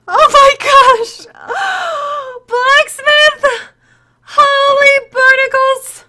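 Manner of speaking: high, loud, drawn-out cries of amazement; ecstatic shock. Real, not acted. Streamer sees snowflake gift from Blacksmith, ecstatic shouting. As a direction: shout it in stunned, real delight.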